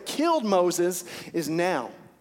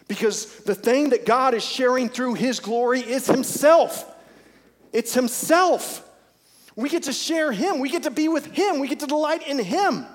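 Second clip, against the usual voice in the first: rising, much higher and louder; fervent preaching; 7 semitones higher, 5 dB louder than usual. Real, not acted. Pastor exhorting the congregation about God's glory, fervent. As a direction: build with fire through each him.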